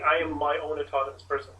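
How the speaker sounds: normal talking voice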